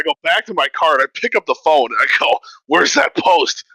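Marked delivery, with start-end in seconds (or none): 2.7-3.6 higher-pitched, eager retold question; mock eager searching. Man rushing to his phone to find the post, excited. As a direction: call it out eagerly, pitch jumping on the repeat.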